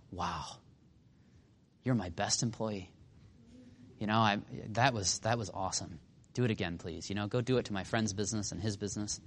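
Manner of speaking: very soft, low, deadpan compliments; underplay the mock admiration, dropping the voice; sarcastic praise. Boss praising an employee who ruined the business, quietly sarcastic.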